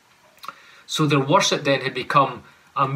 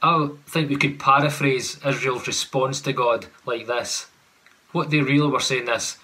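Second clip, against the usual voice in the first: usual speaking voice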